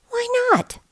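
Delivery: put-on indignant child asking; kid voice. A narrator's reading. Kid protests.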